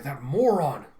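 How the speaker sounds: loud acted insult; exasperated character shout; exasperated outburst. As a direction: burst out in frustration. Kokoro curses Kafka in frustration.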